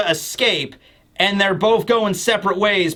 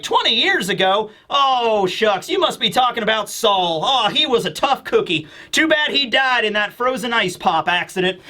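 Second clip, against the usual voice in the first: put-on Bluegrass, southern accent; 4 semitones higher than usual. Bluegrass recalling Saul to Doll, southern accent. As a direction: react with a folksy southern accent. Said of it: "I'm just gonna give her a southern accent".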